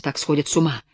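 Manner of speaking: usual speaking voice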